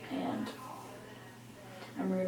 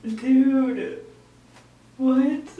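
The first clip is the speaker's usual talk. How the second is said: stunned disbelief; 3 semitones higher, 10 dB louder than usual. Real, not acted. Vlogger staring at her new bangs, stunned disbelief. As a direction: blurt it out in real amazement.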